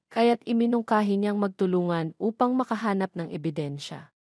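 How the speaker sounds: ordinary delivery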